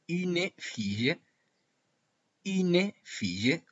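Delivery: une fille slow, une with a rounded French u. Shown as a demonstration. Teacher showing une fille to Spanish class, slow rounded une. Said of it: "se traduce al francés como une".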